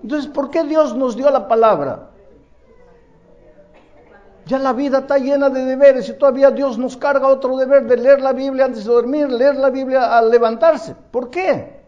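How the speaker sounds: mock complaint. A believer grumbling to God about reading the Bible, loud mock whine. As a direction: complain about God's demands like a fed-up kid.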